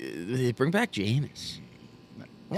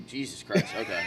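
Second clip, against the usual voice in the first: normal talking voice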